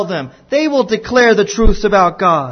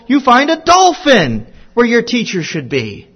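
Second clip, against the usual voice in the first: ordinary talk, nothing marked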